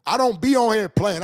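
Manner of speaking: heated, high-pitched tirade; ranting at a fan about his money; angry rant. Real, not acted. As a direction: keep railing at him, offended. Ranting at a critic over weed money.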